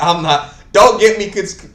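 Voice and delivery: ordinary delivery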